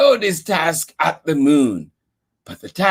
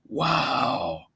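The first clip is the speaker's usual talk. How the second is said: ordinary delivery, nothing marked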